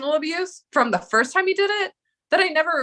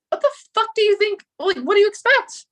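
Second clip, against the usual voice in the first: heated retort; 10 semitones higher, 4 dB louder than usual. Retold or imitated. Retold angry retort to partner.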